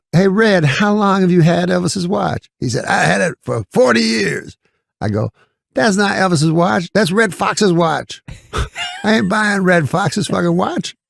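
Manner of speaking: high put-on voice, pitched well up; Eddie Murphy voice. Eddie Murphy retelling his reply to Red Fox in a high voice. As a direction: crank the pitch up and play it indignant. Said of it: "he's doing voices".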